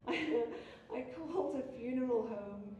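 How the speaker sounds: normal talking voice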